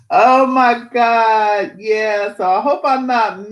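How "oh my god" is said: drawn-out, louder, higher exclamation of surprise; surprised oh my God. Real, not acted. Streamer spotting an old chat friend, slow surprised exclaim. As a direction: stretch it out, delighted surprise. Reacting to a chatter named Pam.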